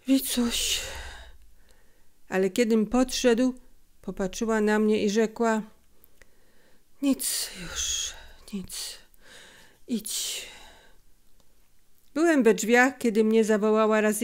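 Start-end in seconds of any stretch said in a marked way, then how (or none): 0.1-0.8 breathy falling call; a sick mother feebly calling her son; tired, broken voice. A narrator's reading. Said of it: "zmęczonym, przerywanym głosem". 7.0-10.5 fading whisper, halting; tired, broken voice. A narrator's reading. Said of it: "zmęczonym, przerywanym głosem".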